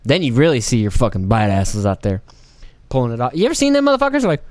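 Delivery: normal talking voice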